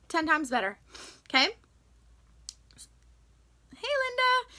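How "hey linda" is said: high, bright call of greeting; cheerful, sing-song greeting. Real, not acted. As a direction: call to Linda as she comes in. Greeting someone arriving.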